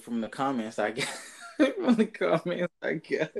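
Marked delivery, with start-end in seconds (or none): 1.6-3.3 laughing through the words. Real, not acted. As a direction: chuckle while reading it out. Laughing while rereading comment line.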